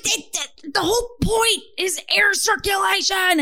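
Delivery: sputtering, high-pitched mock exasperation; mock on-air meltdown; apoplectic expert. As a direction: stammer it out like an expert about to blow. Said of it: "like, having a stroke on air".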